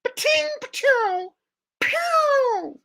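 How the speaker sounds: high ricochet pings; ricochet sound effect. Shown as a demonstration. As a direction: bullets bouncing off metal. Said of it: "it makes the ricochet sound effect".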